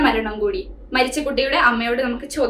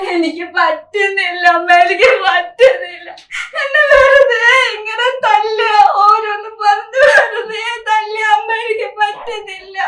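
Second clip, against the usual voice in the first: higher, louder crying wail; sobbing plea; 6 semitones higher, 3 dB louder than usual. Acted. Daughter begging her mother over the phone, crying loudly. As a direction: cry out that she can't bear it.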